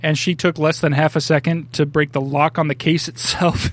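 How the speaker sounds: ordinary talk, nothing marked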